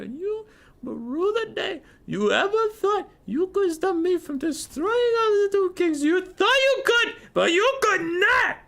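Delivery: hammed-up French-accented shriek, extremely high; mock French accent. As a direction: build to a squealing rant in mock French. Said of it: "His French accent sounds Russian?".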